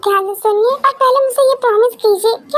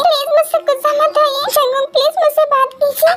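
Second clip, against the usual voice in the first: put-on tearful girlfriend, voice raised higher, begging for a reply; tearful pleading; 6 semitones higher than usual. Girlfriend begs silent Jungkook to forgive her, tearful and pleading. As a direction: plead through tears for him to speak. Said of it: "Stop it, रोना बंद करो।".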